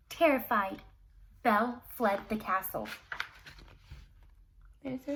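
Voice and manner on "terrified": ordinary delivery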